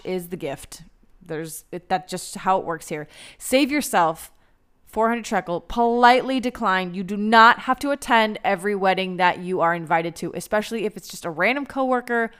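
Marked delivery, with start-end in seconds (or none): none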